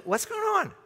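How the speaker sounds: high-pitched voice of a bewildered bystander; puzzled onlooker. Acted. Bystander asking the crowd what's happening, high and puzzled. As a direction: ask it high, like someone lost in the crowd.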